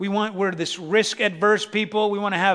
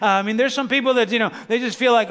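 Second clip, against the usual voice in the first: ordinary talk, nothing marked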